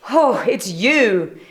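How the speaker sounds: slow, relieved little voice; slow porcupine voice. Acted. Porcupine relieved to see friends, slow. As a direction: let it out slowly, relieved.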